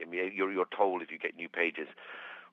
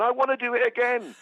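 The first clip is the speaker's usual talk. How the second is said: put-on high excited child voice; like an excited little kid; four-year-old; 4 dB louder than usual. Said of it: "like a four-year-old afterwards going".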